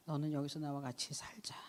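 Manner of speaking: soft, low whisper of God; God's hushed call. Acted. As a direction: whisper the invitation intimately. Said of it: "라는 음성으로 저를 불러주셨어요".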